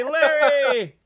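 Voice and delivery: loud, high cheer; hearty toast to a donor; toast shout. Acted. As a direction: raise a glass and shout his name.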